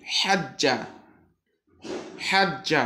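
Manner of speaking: হাজ্জা with the doubled j held long, slow; tashdid drill. Shown as a demonstration. Teacher drills tashdid for students, clear recitation. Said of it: "একবার আবার করে পড়ি".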